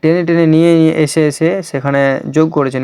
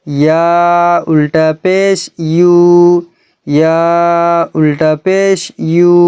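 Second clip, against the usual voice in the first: drawn-out recitation drill; much slower than usual. Shown as a demonstration. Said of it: "তো চলুন".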